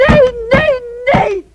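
loud, high, desperate screams; screaming no. Acted. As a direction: scream it out in panic. Daughter screams refusal. (luid en hoog gillend)

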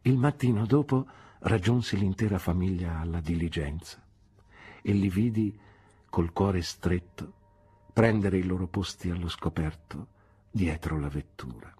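ordinary delivery, nothing marked

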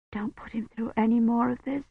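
soft, pleading, close to a whisper; whispered plea. A narrator's reading. Kivrin pleads with God over dying Roche, hushed. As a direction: beg quietly so he won't hear. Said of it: "she whispered in English".

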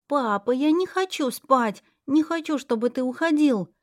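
put-on high whiny child protesting. A narrator's reading. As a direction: whine and plead like a little girl. Child begs her father.

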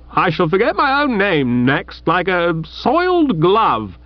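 high, heated rebuke; indignant rebuke. Acted. Chuffy rebukes his old friend Bertie, heated and high. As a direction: dress him down from a moral height. Chuffy's moral outrage, slow stress.

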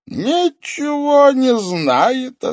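slow build to a high, loud exclamation; gloating sorcerer. A narrator's reading. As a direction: relish the irony word by word.

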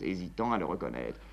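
ordinary talk

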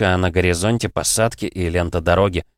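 plain reading voice

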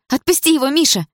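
high, loud urgent plea; scream. A narrator's reading. Dana begging Misha to release Andrei, high scream. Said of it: "закричала Дана".

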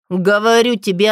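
angry bark. Acted. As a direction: bark the words at him. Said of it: "натурально гавкать на Альфу".

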